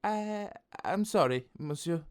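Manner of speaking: put-on high, hesitant French-accented apology; apologetic French baker. A narrator's reading. Baker apologising to the copycat, high French voice. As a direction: stammer a sorry in a high French voice.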